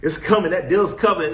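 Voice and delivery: squealing high, loud excitement; mock thrilled, sure the deal is near; giddy anticipation. To himself in the lot, giddy and high. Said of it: "I'm coming at them with this energy".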